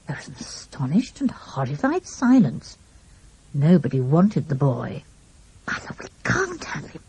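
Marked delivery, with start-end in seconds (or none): 5.7-7.0 softer, higher pleading whisper; agonized whisper. A narrator's reading. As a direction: beg her under your breath. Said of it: "said Pip in an agonized whisper".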